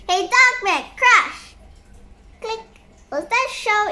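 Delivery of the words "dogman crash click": plain reading voice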